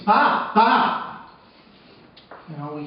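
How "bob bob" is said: mock scream, much higher and louder; screaming his name. Mother shaking her slumped husband, screaming his name, higher and louder. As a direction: shout Bob twice, higher and louder in alarm. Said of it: "shakes him, and she screams".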